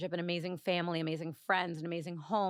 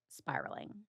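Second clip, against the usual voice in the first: whispered; 8 dB quieter than usual. Real, not acted. Brain spiraling, dramatic trailing word.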